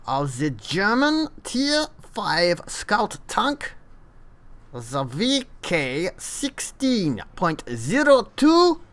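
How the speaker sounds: hammed-up German accent, high and slow; terrible German accent. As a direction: play a cartoonish German tank reviewer. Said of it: "I thought I'd give a German accent a go".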